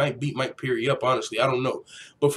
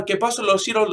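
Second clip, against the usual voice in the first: higher-pitched imitation Mexican accent; Mexican Spanish; 7 semitones higher, faster than usual. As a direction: imitate a fast-talking Mexican Spanish speaker. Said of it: "Spanish-speaking Mexican come up to me".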